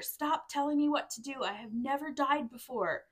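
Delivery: high, loud mock indignation, easing off; acted drunken protest. Reader voices friend kicked out of clubs, mock indignant. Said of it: "Delivered by a friend who got kicked out".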